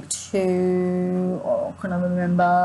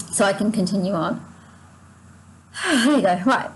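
ordinary talk

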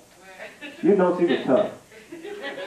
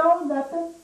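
put-on coy girl's voice; 9 semitones higher than usual. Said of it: "She said".

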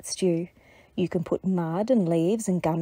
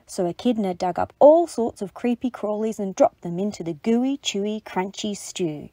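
usual speaking voice

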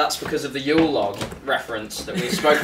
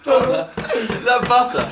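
laughing; 7 semitones higher, much slower than usual. Real, not acted. Laughing at the lump.

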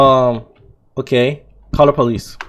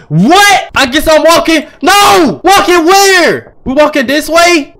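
high, loud disbelieving outburst; loud protest; 8 semitones higher, 5 dB louder, slower than usual. Real, not acted. Gamer finds they must walk, loud high-pitched protest. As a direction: yell it up high in dismay. Forced to walk instead of drive.

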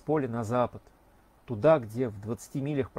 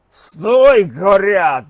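a high, much louder alarm yell; 6 semitones higher, 13 dB louder, much slower than usual. Acted. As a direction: scream it after the rider at full lungs. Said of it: "заорал штурмовик".

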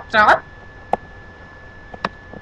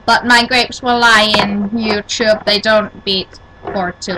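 ordinary talk, nothing marked

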